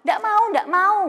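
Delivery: mock a sulky kid refusing to bathe; whiny child refusing. Child whining to mom about bathing, high mock whine. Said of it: "Nangis-nangis dulu".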